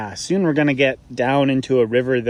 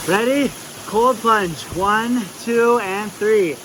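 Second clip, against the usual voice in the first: high, raised-voice countdown; bracing countdown; 9 semitones higher, 3 dB louder, slower than usual. Real, not acted. Hiker counting down to a cold plunge, bracing and raised. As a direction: psych yourself up and call the count.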